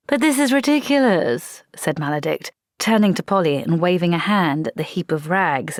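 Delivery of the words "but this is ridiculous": exasperated. Acted. Vampire exasperated at the equipment.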